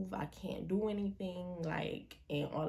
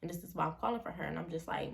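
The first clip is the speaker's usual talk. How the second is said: ordinary talk, nothing marked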